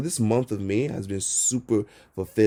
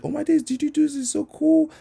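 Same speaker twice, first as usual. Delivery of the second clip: much higher, squeaky excited gush; amazed fan; 3 dB louder than usual. Retold or imitated. Passersby catching him painting, imitated in a high amazed gush. People amazed seeing his art.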